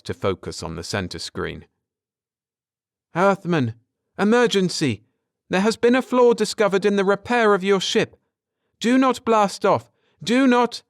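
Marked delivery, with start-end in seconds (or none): 3.1-10.8 high, loud, rushed alarm call; urgent emergency warning. Acted. Screen voice warning the Earthman not to blast off, urgent and loud. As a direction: warn him off in a panic before he launches. Ship's screen warns of a flaw.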